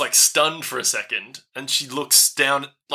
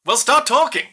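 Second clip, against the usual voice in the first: high, louder impatient demand; demanding gnome; 11 semitones higher, 5 dB louder than usual. Acted. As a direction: push them to speak now. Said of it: "she says".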